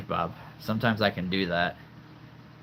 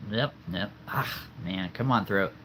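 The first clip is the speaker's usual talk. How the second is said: usual speaking voice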